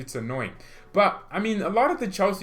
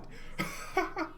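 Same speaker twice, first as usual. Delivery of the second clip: high giggle; 8 dB quieter than usual. Real, not acted. Laughing at own look.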